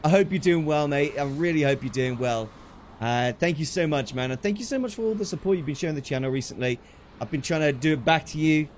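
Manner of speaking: ordinary talk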